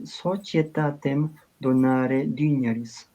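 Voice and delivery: slow liturgical Latin, "societatem" with a ch sound; slow Italianate Church Latin reading; ecclesiastical Latin. Shown as a demonstration. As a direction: read it carefully like Church Latin at Mass. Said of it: "Latín, el latín litúrgico".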